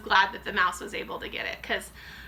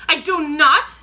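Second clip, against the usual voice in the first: loud, higher, furious no; witch shouting; 6 semitones higher, 12 dB louder, slower than usual. A narrator's reading. Grand High Witch shouting down at the grandmother. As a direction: bark the denial. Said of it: "shouted the Grand High Witch".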